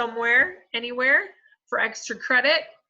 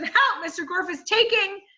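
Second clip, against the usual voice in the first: much higher, loud, desperate cry; shouting for help; 10 semitones higher, 5 dB louder than usual. A narrator's reading. Allison cries for help to Miss Mush, loud and high. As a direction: scream for rescue before being cut off. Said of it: "shouted Allison".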